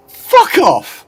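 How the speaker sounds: usual speaking voice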